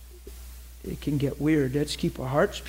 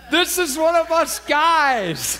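loud, high, drawn-out exclamation; emphatic holler; 13 semitones higher, 6 dB louder, much slower than usual. Retold or imitated. Preacher tells the crowd about the crying man, emphatic holler. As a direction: holler it slowly, stretching the last word. Preacher stressing the crier's manhood.

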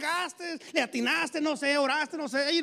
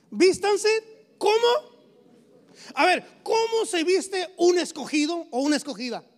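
emphatic, louder preaching; 3 semitones higher, 5 dB louder than usual. Acted. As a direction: drive it home to the congregation. Preaching at full force. (predicando con fuerza)

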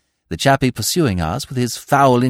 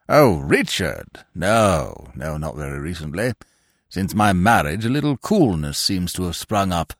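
put-on pompous old lord; 9 semitones lower than usual. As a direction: speak down from great dignity, deep and measured. Pompous peer speaks.

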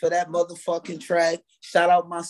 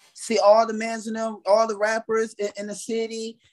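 ordinary talk, nothing marked

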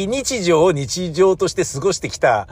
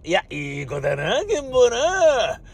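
doting festival uncle; 9 semitones higher than usual. Retold or imitated. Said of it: "とか".

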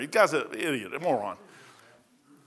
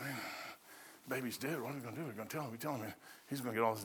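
near-whisper, much softer, low; hushed servants; 7 semitones lower, 20 dB quieter, much faster than usual. Retold or imitated. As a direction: murmur it like afraid to be overheard. Servants fear telling David.

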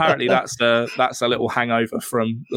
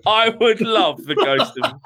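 laughing; 7 semitones higher than usual. Real, not acted. Laughing line.

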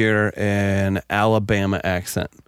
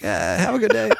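awkward brush-off; 8 semitones higher, 3 dB quieter than usual. Retold or imitated. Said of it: "you'd be like".

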